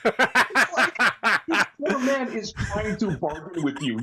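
talks through laughter, high and loud; laughing. Real, not acted. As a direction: protests while cracking up. Laughing while protesting.

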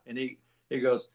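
ordinary delivery

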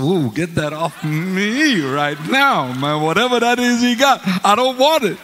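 slow, laughing, then high and loud refusal; laughing mock recoil. About an unwanted preacher, laughing mock recoil. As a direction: shove it away while laughing.

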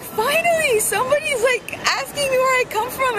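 delighted squeal of relief; thrilled relief. Retold or imitated. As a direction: gush like a kid who's finally been noticed. Relieved kid finally asked about America.

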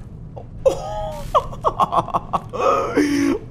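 high laugh edging into a cry; nervous high laugh. Real, not acted. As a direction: laugh half in panic. Laughing at jump scare. (ضحكة متوترة عالية)

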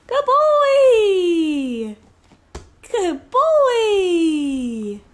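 squeaky praising a dog. Real, not acted. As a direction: gush over the dog. Praising dog after fetch.

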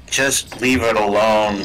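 slow, stretched-out plea to drop it; drawn-out insistence. Real, not acted. Telling a co-player to leave alien stuff alone, drawn-out. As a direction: stretch every word out slowly, insistently.

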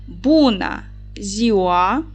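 Буна зіуа slowly, syllable by syllable in Romanian; Romanian greeting. Shown as a demonstration. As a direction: spell out Буна зіуа carefully like a teacher. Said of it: "це означає «добрий день»".

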